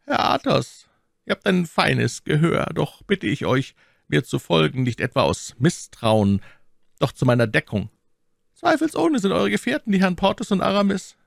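high-pitched, courteous man of power; commanding cardinal. A narrator's reading. Voices the cardinal in narration.

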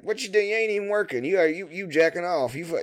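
high-pitched mock accusation; flustered foreman imitation. Foreman trying to get the worker out, high mock bluster. Replays caught foreman shooing him away.

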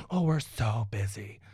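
slow, sneering imitation of a busy manager; mocking the apartment managers' whiny excuse. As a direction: mimic their lame excuse with contempt.

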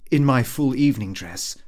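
ordinary delivery, nothing marked

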